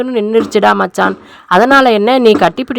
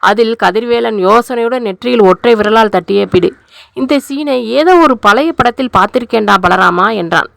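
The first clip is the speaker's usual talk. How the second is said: plain reading voice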